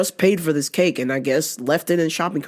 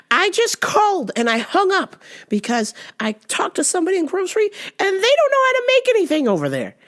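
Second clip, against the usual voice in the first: put-on much higher, fussy older lady on the phone; confused lady caller; 13 semitones higher than usual. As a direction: play the lost caller, pitch way up. Voicing the caller.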